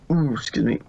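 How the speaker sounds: ordinary delivery, nothing marked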